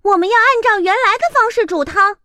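高而响，凶巴巴; 猫厉声训斥; fierce cat. Acted. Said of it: "猫凶巴巴地说". (猫凶巴巴地训斥鸭子)

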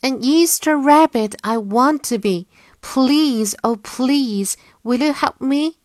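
high, loud begging bunny voice; bunny calling out. A narrator's reading. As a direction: call out with eager pleading.

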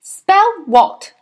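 high, loud command; barked order. A narrator's reading. Headmistress tests the boy's spelling, barking. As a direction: fire it at the pupil like a drill order.

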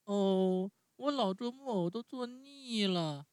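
高出许多的童声，嚷着抱怨; 像受够了的小孩那样抱怨; 木偶不耐烦地叫嚷. Acted. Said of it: "拍着后脑勺大叫着说". (用很高的童声不耐烦地嚷嚷)

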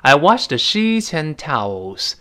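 I washed the sheets and towels read slowly again; 慢读整句示范; slow model sentence. Shown as a demonstration. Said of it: "读快之后". (放慢示范 I washed the sheets and towels)

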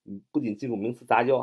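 ordinary talk, nothing marked

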